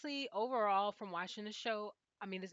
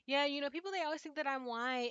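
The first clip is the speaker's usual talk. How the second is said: a lightly voiced quote, pitched higher; a hint of Nastasia's voice, higher; imitating Nastasia; 6 semitones higher, 3 dB louder than usual. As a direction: mimic the German girl lightly, a bit louder. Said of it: "And she was saying, like".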